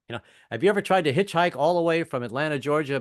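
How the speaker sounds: ordinary talk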